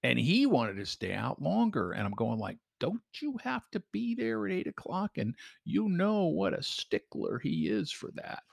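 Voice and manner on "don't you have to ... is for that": high warning voice. Retold or imitated. Retold warning to new guy.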